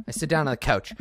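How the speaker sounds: ordinary talk, nothing marked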